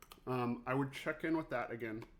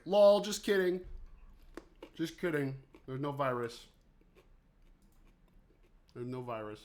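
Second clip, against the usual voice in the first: mocking prank voice; 5 dB louder than usual. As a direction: sneer it out like a prankster. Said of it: "China's just like".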